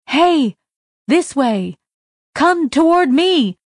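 high, loud urgent calls; calling out. A narrator's reading. The voice calling the girl to safety, loud urgent calls. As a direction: call her toward safety. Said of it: "The voice changed".

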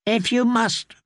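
put-on high, grudging child voice; sulky child. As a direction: give in like a sulking boy. Boy lord voiced in story.

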